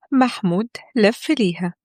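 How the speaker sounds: plain reading voice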